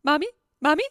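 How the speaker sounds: a playful imitation of a chick calling its mother; duckling calling for mom. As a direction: call like a lost duckling. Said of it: "like a little duckling looking for its mom".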